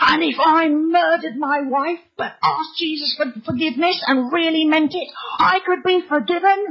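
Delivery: the furious host bellowing through the hatch; furious demand. Retold or imitated. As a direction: shout it, pitch climbing high. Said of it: "his furious purple face appeared".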